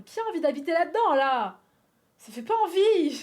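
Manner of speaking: loud, high outraged question; loud indignant rant; indignant outburst. Real, not acted. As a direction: nearly shout it in disgust.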